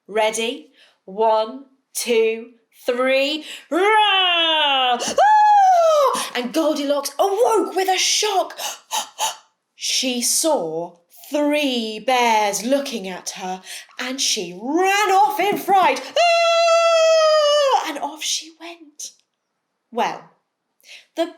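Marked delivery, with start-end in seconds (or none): 3.7-5.0 loud, long roaring bear growl; big bear roar. Acted. Storyteller leads the children in a bear roar. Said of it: "a really big roar". 5.0-6.3 very high, loud startled scream. Acted. Goldilocks wakes to bears, high screaming. 16.1-17.9 very high, loud sung-out scream. Acted. Goldilocks flees the bears, high loud scream.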